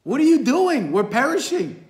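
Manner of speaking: high, loud panicked cry, stammering at first; acting panicked. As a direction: act out frantic disciples pleading for their lives. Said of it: "they panic and they run to Jesus".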